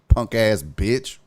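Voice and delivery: usual speaking voice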